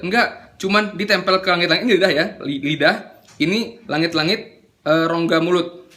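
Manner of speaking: normal talking voice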